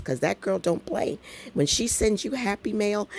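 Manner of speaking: normal talking voice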